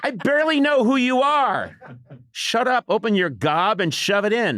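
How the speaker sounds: a hint of an exasperated father; impatient dad telling the kid to eat; gruff mock dad. Father snaps at his fussy son. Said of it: "my father saying".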